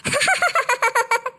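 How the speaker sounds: gleeful ha-ha cackle; long mocking laugh burst; taunting laughter. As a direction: laugh at your sister being scolded. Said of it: "பல்ல பல்ல காட்டாம இருட்டி".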